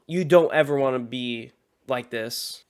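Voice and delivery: ordinary talk, nothing marked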